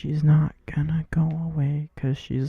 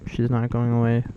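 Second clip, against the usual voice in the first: usual speaking voice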